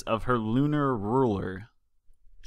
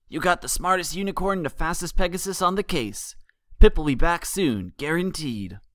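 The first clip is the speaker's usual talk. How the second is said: proud smile; 6 semitones higher than usual. A narrator's reading. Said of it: "Dash spoke up with a proud smile".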